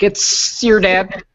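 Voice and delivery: high, loud, slow goofy voice; goofy dad greeting. Retold or imitated. A college friend greeting people in a slow high put-on voice. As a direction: stretch it out like a silly prank. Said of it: "he'd be like".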